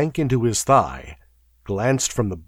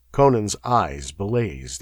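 plain reading voice

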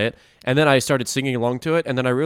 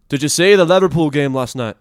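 loud, higher Scouse accent; Liverpool accent; 5 semitones higher, 10 dB louder than usual. Shown as a demonstration. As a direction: ask it like a Liverpool fan down the pub. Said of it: "That did sound like [name]".

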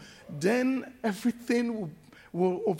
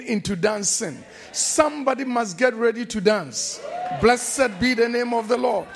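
rising, shouted praise to the congregation; preaching at full force; 3 semitones higher, 4 dB louder than usual. Acted. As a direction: build it up and shout the blessing.

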